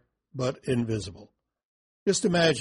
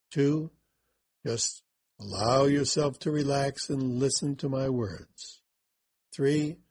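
guided meditation. Acted. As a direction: ease every body part into rest. Counting relaxation induction.

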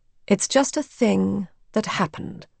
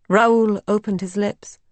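plain reading voice

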